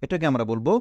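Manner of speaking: ordinary delivery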